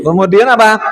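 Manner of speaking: ordinary talk, nothing marked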